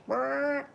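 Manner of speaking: high, loud panicked squawk; chicken squawk. Retold or imitated. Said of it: "the noise the chicken makes".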